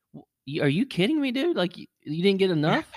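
high, disbelieving are you kidding me; incredulous outburst. Retold or imitated. Guy reacting to nurse wanting the second nostril, incredulous. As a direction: pitch jumps way up in mock outrage. Outraged reply to second swab.

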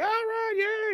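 very high cheering; mock cheer. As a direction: squeal a happy yay. Crowd cheers.

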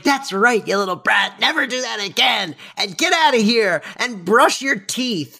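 high, mock-stern nanny scolding a brat. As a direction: wag a finger at a naughty kid. Fran scolding the boy.

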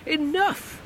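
high, slow shouted command; Comtesse shouting. Acted. Comtesse shouting at Bernard to stop. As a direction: shout him down as the lady. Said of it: "the Comtesse shouted".

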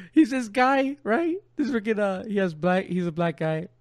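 usual speaking voice

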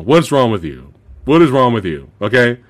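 ordinary talk, nothing marked

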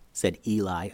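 usual speaking voice

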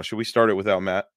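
ordinary talk, nothing marked

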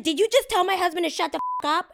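high, loud mock-outraged wife; imitated outraged yell. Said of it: "you guys are yelling at me".

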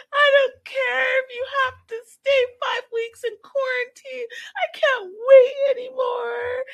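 high, wailing fake-sob voice mocking her; mock crying whine. Mocking the crying girl with a shrill sobbing whine. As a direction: whine it like a melodramatic crybaby.

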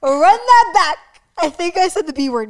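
loud, high, alarmed call; high-pitched mock alarm; startled at own slip. Real, not acted. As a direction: blurt it out, caught by your own slip. Startled by own accidental swear.

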